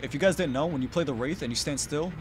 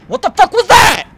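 very loud, high disbelieving shout; outraged yell; 18 dB louder than usual. Real, not acted. Player stunned by a dumb trick that worked, outraged yell. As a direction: explode in disbelief at full volume.

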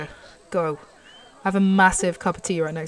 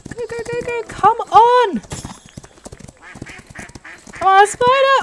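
high, loud cheering-on; frantic urging; 13 semitones higher, 6 dB louder than usual. Real, not acted. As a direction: yell the horse on towards the finish. Urging the horse on in a race.

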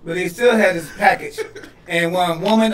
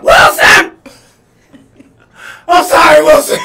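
loud, anguished movie-castaway shout; mock yelling out; 9 semitones higher, 11 dB louder than usual. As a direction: cry out for a lost companion, hammed up. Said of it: "I bet she yell out".

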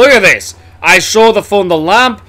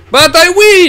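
triumphant shout; 13 semitones higher, 5 dB louder than usual. Real, not acted. Triumphant gamer cry.

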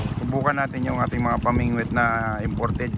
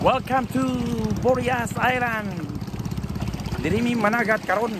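announcer welcome; 11 semitones higher than usual. Acted. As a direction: roll it out like a TV host unveiling the island. Grand welcome to the island. (masiglang pagbati na parang host ng palabas)

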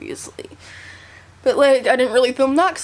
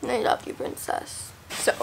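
whispering; 10 dB quieter than usual. Real, not acted. Soft aside to baby daughter.

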